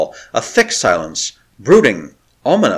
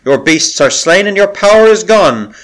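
louder and higher, calling across to the enemy; ringing proclamation; 8 semitones higher, 6 dB louder than usual. A narrator's reading. Priestess calls out to the besieged chamber, loud and high. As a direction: announce it like a herald's challenge. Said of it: "she cried again".

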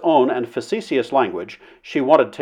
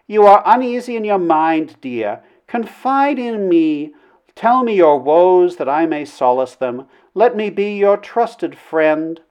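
high, slow, drawn-out lady's voice; wooing princess, tender; 5 semitones higher, slower than usual. A narrator's reading. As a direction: coax him to confide, high and slow. Princess coaxes him to confide.

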